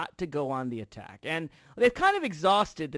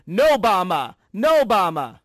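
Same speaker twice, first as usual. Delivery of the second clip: loud, emphatic protest chant; crowd shouting; 8 dB louder, slower than usual. Acted. Host correcting himself on what rallygoers shout, loud chanting. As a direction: punch each shout like a hostile crowd. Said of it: "No, they're shouting".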